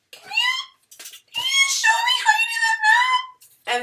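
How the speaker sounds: very high, mock-sweet squeal rising to a shout; high, slow mock-polite Karen voice building to a shout; inner Karen. Shown as a demonstration. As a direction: condescend in a squeaky falsetto. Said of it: "my inner Karen".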